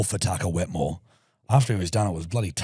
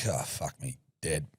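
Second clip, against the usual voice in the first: groaning under breath; 8 semitones lower, 11 dB quieter, slower than usual. Retold or imitated. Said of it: "And I'm like".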